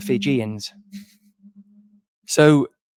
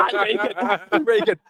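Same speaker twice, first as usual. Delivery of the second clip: laughing; 8 semitones higher, 4 dB louder than usual. Real, not acted. Laughing at tortoise joke.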